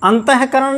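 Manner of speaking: अंतःकरण with a voiced he before करण; wrong visarga. Shown as a demonstration. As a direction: say अंतःकरण as an-ta-he-karan. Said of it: "नहीं".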